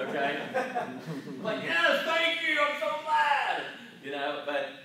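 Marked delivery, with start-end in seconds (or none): 1.6-3.6 high, slow, sugary fake delight; mock overjoyed. Mocking a smug believer to the congregation, high and syrupy. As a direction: gush like a falsely thrilled churchgoer.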